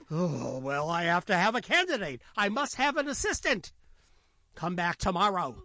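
exasperated lion. Acted. Lion insists on candidate.

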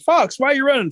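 high-pitched urgent holler; calling out. Acted. Rabbit catches up with Fox, calling out. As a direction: Rabbit hollering after a runner.

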